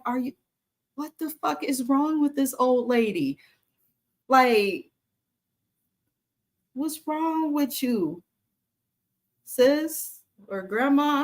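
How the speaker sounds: high, drawn-out exasperated voice; slow, put-on disbelief. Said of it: "I'm like".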